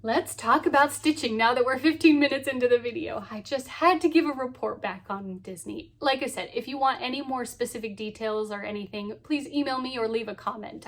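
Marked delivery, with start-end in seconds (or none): none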